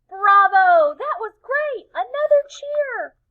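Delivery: very high, loud cheering; excited cheering. Acted. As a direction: shout for an encore. Crowd cheering for more.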